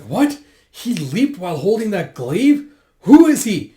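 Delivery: high, loud disbelief building to a shout; astonished. Retold or imitated. Soldiers seeing him leap with the glaive, astonished and loud. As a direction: cry out in amazement.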